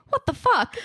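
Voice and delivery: lightly voiced little boy blurting it out high; imitating toddler. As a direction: mimic the kid's cheerful swear, what said brightly. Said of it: "'cause he's just saying".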